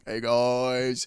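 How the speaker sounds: drawn-out mock greeting; hammed-up call to the boys; imitating group-chat announcement. Host mimicking the actor's posts to fans, hammed-up announcement. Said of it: "like sending a group text message going".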